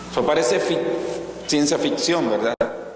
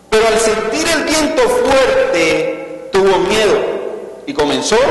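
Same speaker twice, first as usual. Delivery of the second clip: dramatic scripture reading; 3 semitones higher than usual. Acted. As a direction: read the story at full preaching force.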